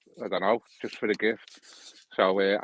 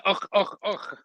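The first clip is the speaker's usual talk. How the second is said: higher mocking grunts, teasing a Scottish accent; 8 semitones higher, slower than usual. Teasing a friend about his Scottish family, mock grunts. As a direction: grunt it out like a caricature Scot. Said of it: "you walk around going".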